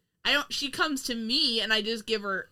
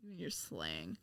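whispered; 3 semitones lower, 14 dB quieter than usual. Real, not acted. Exasperated aside.

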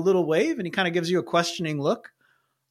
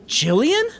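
higher, questioning call; surprised acquaintance; 9 semitones higher than usual. Acted. Someone spots a familiar face at the mission, surprised. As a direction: call the name with a steep upward lift. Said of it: "you hear a voice".

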